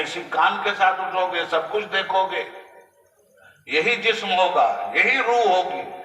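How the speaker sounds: high, ringing sermon cadence; forceful preaching. Real, not acted. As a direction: drive each "उठोगे" home like a preacher. (ऊंचे सुर में जोशीला was)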